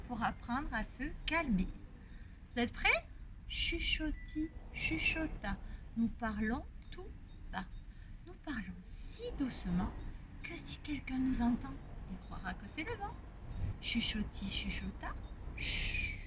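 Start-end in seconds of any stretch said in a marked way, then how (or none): 3.5-7.6 soft hushed rhyme voice; library whisper. Shown as a demonstration. 8.3-13.1 soft whisper under the breath; library whisper. Shown as a demonstration. Whispered rhyme continues. 13.8-16.2 fading whisper into a shush; library whisper. Shown as a demonstration. Rhyme ends in a hush.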